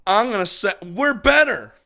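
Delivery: high, loud defiant declaration; loud boast. Real, not acted. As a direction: blurt it out loud and proud. Brash boast sparks mock flame war.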